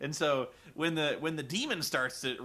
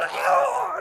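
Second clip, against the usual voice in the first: mock scream; 8 dB louder than usual. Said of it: "She's like".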